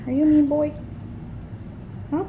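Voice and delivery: high playful pet talk; squeaky teasing a dog. Real, not acted.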